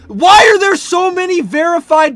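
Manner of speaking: high, loud exasperated yelling; incredulous shouting, drawing out the question; outraged disbelief. Real, not acted. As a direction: yell in baffled outrage. Outraged at chat claims about him.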